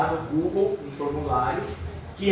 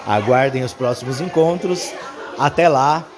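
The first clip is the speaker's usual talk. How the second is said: announcer voice; 3 semitones lower, 4 dB louder than usual. Acted. Closing announcement for video.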